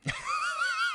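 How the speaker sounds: high squealing laugh burst; shrieking laugh. Real, not acted. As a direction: let out a shrill laugh.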